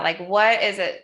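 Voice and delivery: usual speaking voice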